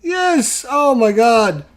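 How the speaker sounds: high, loud victory yelp; triumphant cheer. Real, not acted. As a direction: shout for joy at the roll. Winning roll after near loss.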